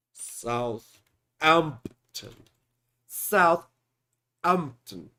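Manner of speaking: slow, deliberate saying while typing; carefully pronounced for voice search; slow dictation. Real, not acted. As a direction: draw the name out very slowly. Searching again.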